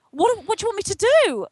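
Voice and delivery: excited high-pitched eagerness; eager retold question. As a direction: blurt it out like she has waited years for this. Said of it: "I said".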